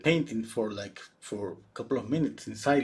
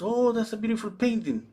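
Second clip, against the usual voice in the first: gushing praise; 10 semitones higher than usual. Retold or imitated. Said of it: "and she was".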